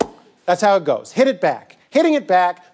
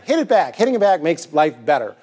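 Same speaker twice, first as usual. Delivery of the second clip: normal talking voice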